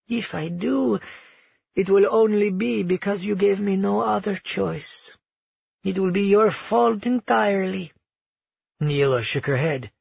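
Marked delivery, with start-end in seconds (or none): 0.1-7.9 lightly voiced, higher-pitched villain; calmly menacing grandmother. Grandmother threatens the child's protectors, calm menace.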